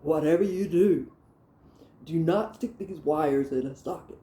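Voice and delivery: dead-serious retold warning; imitating a stern father's warning; real serious dad. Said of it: "and he's real serious".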